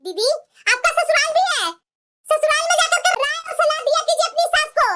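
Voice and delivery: high, indignant comeback; angry retort. Acted. Daughter-in-law telling off interfering sister-in-law, angry retort.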